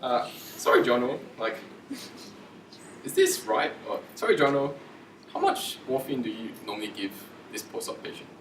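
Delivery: apologetic, tentative asking; hesitant polite query, easing off at the end; nice, indirect. Shown as a demonstration. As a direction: check politely without accusing. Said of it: "if you say it in a nice way".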